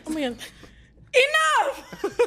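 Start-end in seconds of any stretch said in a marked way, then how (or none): 1.1-2.3 laughing shout. Acted. Acted outburst in silly story game.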